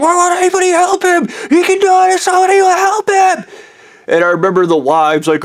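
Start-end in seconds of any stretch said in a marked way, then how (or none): 0.0-3.4 very high, frantic scream; retold screaming. Speaker to the wives, begging help for a man, screaming. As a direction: scream it high and louder, pleading for someone to act. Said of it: "I remember screaming".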